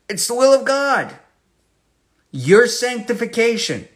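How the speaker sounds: high, loud declaration; ringing pulpit emphasis; preaching emphasis. Real, not acted. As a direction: proclaim the verse boldly.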